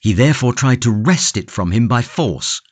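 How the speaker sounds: ordinary delivery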